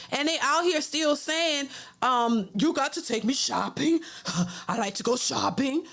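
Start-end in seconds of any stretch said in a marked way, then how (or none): 2.5-5.8 put-on croaky old lady voice with a frog in the throat; mocking old woman voice. Mocking sixty-year-old LisaRaye begging a man for shopping, croaky. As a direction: imitate an old diva begging to be taken shopping. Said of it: "like a frog in my throat".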